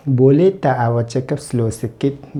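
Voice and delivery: very slow, each word laid out for learners; slow, careful Malay for students; slow teaching demo. Shown as a demonstration. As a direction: spell it out slowly so a beginner can follow. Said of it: "teacher demonstrating Malay phrase".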